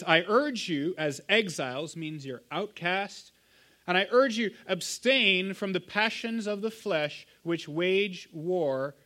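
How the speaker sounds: slow, weighty reading of the verse; solemn scripture reading. Acted. Pastor reading Peter's plea to the congregation, slow and weighty. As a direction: read the apostle's plea slowly, leaning on urge.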